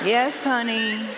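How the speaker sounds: slow, soft, sugary "Yes, honey"; a touch of mock sweetness; sweet submissive wife. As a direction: play the meek, adoring wife. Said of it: "I tried to talk softer and sweeter".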